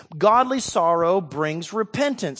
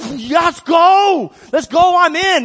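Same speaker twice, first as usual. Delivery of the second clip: much higher and louder, all fired up; eager battle shout; 11 semitones higher, 9 dB louder, slower than usual. Retold or imitated. Abishai volunteering to go to Saul, eager shout. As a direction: yell it like a warrior ready to charge. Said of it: "And Abishai's like".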